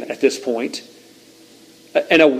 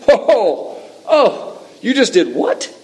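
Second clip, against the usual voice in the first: high-pitched, scandalized double take; acted shock; 7 semitones higher, much slower than usual. Preacher imagines hearing dad insult mom at dinner, shocked. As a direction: react in mock disbelief, voice jumping up.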